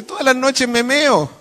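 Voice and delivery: high, drawn-out tearful whine; hammed-up crying patient, slow and high; mock crying. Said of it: "Va llorando donde el psiquiatra este hombre". (quejido llorón exagerado)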